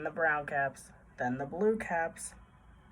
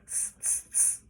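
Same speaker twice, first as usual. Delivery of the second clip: three short hissed "tss" sounds; monkeys' tutting; 8 dB quieter than usual. A narrator's reading. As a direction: mimic chattering monkeys with sharp tsks. Monkeys mimic the scolding peddler.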